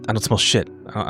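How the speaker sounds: usual speaking voice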